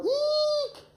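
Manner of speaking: very high, held eek; high squeal. Shown as a demonstration. Coach showing Grandma a fun frightened sound, squealing. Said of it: "Can you make that sound".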